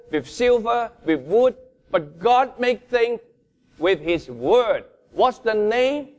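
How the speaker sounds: preaching at full force. Acted. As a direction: preach it out with passion. Climax of sermon, pitch raised.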